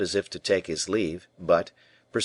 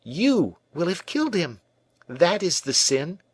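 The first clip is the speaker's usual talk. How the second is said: high, cold and pitiless; pitiless accusation; 10 semitones higher than usual. A narrator's reading. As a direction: drive the blame home. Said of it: "continued her pitiless tormentor".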